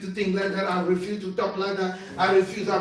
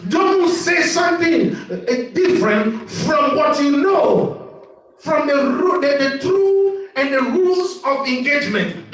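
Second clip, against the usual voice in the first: raised pitch, emphatic drawn-out commands; forceful preaching exhortation; 4 semitones higher, 4 dB louder, slower than usual. Real, not acted. Preacher urging a friend to stay strong in faith, forceful exhortation. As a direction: drive each command home to the listeners.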